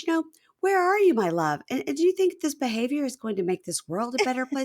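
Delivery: high-pitched pleading wife; Nicole. Retold or imitated. Wife questioning husband's behavior over the phone, mock pleading. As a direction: imitate a spouse gently remonstrating. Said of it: "she goes".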